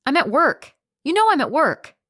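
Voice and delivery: exasperated. Acted. Wife answers drunk husband.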